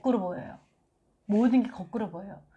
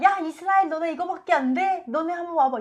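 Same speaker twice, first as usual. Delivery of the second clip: taunting giant; 7 semitones higher than usual. Retold or imitated. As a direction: jeer at the enemy army. Goliath taunting Israel's army.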